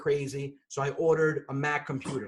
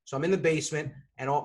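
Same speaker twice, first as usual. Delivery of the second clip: ordinary talk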